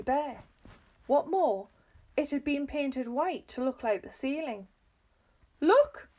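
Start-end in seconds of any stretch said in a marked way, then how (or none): 5.6-6.1 high, loud shriek; screamed. A narrator's reading. Missus Twit pointing out the floor to her husband, screaming. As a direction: scream it out in alarm, pitch soaring. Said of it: "screamed Missus Twit".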